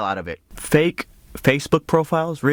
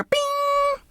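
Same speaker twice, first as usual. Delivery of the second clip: ringing high "bing" held out; long piercing mock chime; high-pitched ping. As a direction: imitate a gadget's flash, very high. Imitates the memory wand flash.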